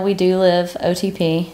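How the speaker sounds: normal talking voice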